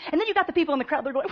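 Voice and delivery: ordinary delivery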